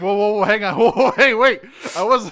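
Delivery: high, loud comic panic with laughter; hammed-up frantic pleading to stop; panicked backpedaling. Villain whose bluff was called, backpedaling in loud panic.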